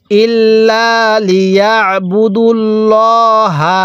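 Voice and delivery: very slow ইল্লা লিয়াবুদুল্লাহা with long held vowels; model recitation. Shown as a demonstration. Teacher shows how to learn it, slow drawn-out recitation. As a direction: chant ইল্লা লিয়াবুদুল্লাহা, stretching লা and ল্লা. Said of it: "এরকমভাবে শিখব".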